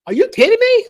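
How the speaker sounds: high-pitched exasperated disbelief; incredulous outrage. Real, not acted. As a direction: squeal it in outrage. Outraged rhetorical reaction.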